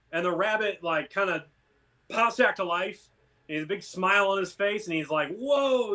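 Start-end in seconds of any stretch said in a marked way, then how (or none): 5.4-5.9 higher, delighted "whoa"; joyful wonder, lightly acted; really happy. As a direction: act out happy amazement. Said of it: "you know, like, really happy".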